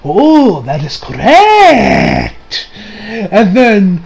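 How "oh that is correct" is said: very slow, drawn-out mock gloating; imitated self-satisfied villain; smug. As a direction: stretch it out with a smirk. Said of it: "he just has this smug smirk on his face".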